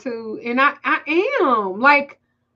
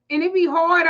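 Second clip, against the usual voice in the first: usual speaking voice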